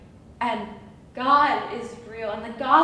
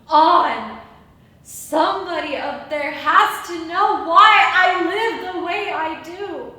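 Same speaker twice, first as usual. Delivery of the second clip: shouting; 2 semitones higher, 7 dB louder than usual. Acted. Crying out to heaven.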